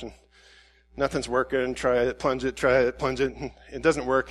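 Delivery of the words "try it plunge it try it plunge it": low, careful little plunges; polite little plunging. Retold or imitated. Preacher acting out careful toilet plunging, politely restrained. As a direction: imitate dainty, restrained effort. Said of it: "polite little plunging where you try not to splash".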